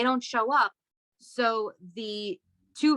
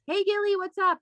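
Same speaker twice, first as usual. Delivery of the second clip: bright phone hello; 9 semitones higher than usual. Retold or imitated. Answering the boyfriend's mother.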